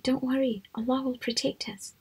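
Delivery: soft, hushed reassurance; calm reassurance. Acted. Prophet calming his frightened companion, soft and hushed. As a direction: soothe him in a near whisper.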